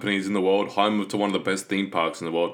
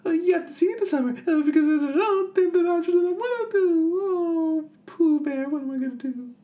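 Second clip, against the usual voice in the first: very high, anxious little piglet; fretful tiny piglet fussing to Pooh. Retold or imitated.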